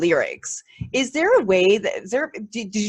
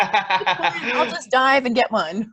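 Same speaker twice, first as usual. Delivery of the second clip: slow, laughing through the words; chuckling while joking; 3 semitones higher, much slower than usual. Pretend. Joking about diving for underwear.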